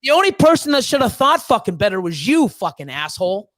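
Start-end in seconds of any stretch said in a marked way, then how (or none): none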